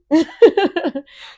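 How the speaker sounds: a sudden high, loud laugh; high burst of laughter. Real, not acted. Laughing at bad pilots.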